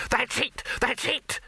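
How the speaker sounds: high-pitched triumphant shout; excited yell. A narrator's reading. Dibbler jumping up and down, yelling excitedly. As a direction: yell it in two quick bursts. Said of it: "he yelled".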